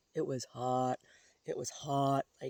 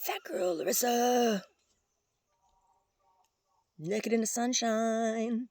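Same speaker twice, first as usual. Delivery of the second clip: high, loud, drawn-out squirrel taunt; slow sing-out as a jeering squirrel; mock squirrel voice; 9 semitones higher, 6 dB louder, slower than usual. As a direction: stretch it into a high teasing call. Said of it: "Squirrel says".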